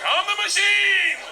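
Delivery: high, drawn-out bellow; triumphant roar at the top of the voice; proud shout. Acted. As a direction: shout it out, stretching the last word. Repeats botched line louder to gangster.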